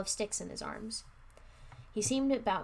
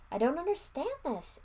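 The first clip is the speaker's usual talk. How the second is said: higher-pitched, bewildered moan; 8 semitones higher than usual. A narrator's reading. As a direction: moan it in confusion. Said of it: "Eddie moaned".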